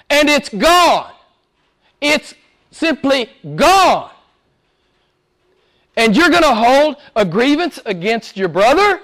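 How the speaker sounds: fiery pulpit preaching; preaching at full force. Acted. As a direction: preach it hard to the congregation.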